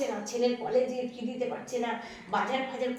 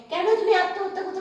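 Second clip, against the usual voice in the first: alarmed reproach; 9 semitones higher, 6 dB louder than usual. Acted. Visitor scolds about suicide.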